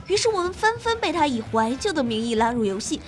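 normal talking voice

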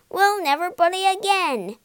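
high, loud frightened promise; terrified. A narrator's reading. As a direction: plead in terror.

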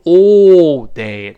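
loud, slow, pursed British "all day"; wrong British all day. Shown as a demonstration. Teacher showing the wrong British way to say it. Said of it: "不要读all day".

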